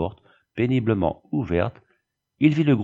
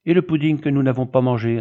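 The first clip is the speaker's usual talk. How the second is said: put-on complaining child; 4 semitones higher, 4 dB louder, faster than usual. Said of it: "ajouta Odette".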